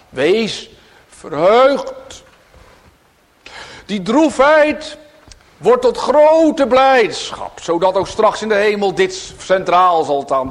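loud, high, slow exultation; joyful fervent proclamation; preaching at full force. Acted. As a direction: lift them into joy.

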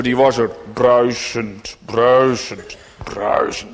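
bruisend said loud then fading to a breath; fading repetition. Acted. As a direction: let bruisend die away each time. Repeated word fading.

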